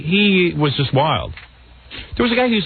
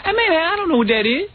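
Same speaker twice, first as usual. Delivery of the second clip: playing stupid; 13 semitones higher than usual. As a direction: act clueless in a high mock accent. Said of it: "you have to talk stupid".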